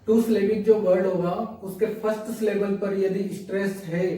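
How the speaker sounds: usual speaking voice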